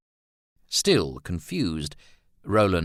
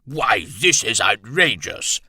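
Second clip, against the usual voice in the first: slow, loud, indignant colonel; exploding; 4 semitones higher, 6 dB louder than usual. A narrator's reading. Colonel outraged by the painters and model, exploding slowly and loudly. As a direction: explode with outrage, drawing out the words. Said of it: "Bennett exploded".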